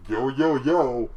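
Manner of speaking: slow, loud hype man drawl. Retold or imitated.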